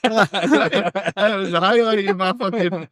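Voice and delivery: high, slow words through laughter; laughing. Real, not acted. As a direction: still cracking up while saying it. Laughing at the end of the story.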